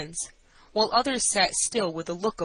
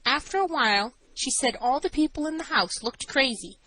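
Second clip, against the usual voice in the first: high-pitched woman witness; nervous assistant matron; 6 semitones higher than usual. A narrator's reading. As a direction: testify anxiously in a raised voice.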